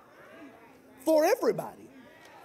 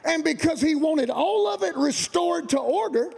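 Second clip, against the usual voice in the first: higher, shouted, stretched preacher build; preaching climax; 6 semitones higher than usual. Real, not acted. Pastor driving home God loves all of it, shouting. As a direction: build the congregation to a peak.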